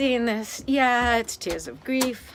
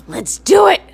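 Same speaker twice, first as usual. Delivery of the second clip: excited; 8 semitones higher, 14 dB louder than usual. Real, not acted. Repeats it loudly, energized.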